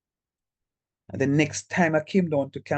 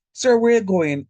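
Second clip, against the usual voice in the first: raised, louder call after the teacher; student calling out; 7 semitones higher, 8 dB louder than usual. Retold or imitated. Students call out after their teacher, louder and higher. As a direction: shout it after him as he storms off.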